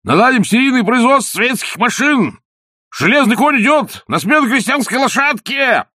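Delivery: high, loud rally cries; shouted slogans. A narrator's reading. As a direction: yell the slogans after the car. Official shouts slogans at car.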